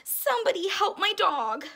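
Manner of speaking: high, loud cry for help; acted boy's shout; pleading yell. A narrator's reading. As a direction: yell out a desperate plea. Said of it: "yelled Mark".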